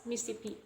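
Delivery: Mississippi shortened to "Mis-sippi"; deleted syllable. Shown as a demonstration. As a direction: leave one "si" out of Mississippi. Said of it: "we just pronounce it as".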